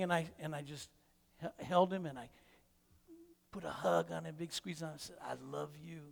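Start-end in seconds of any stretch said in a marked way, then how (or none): 5.2-6.0 very soft, hushed affection; quiet, tender reassurance. Retold or imitated. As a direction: murmur it gently into a hug. Hugging upset grandson tightly.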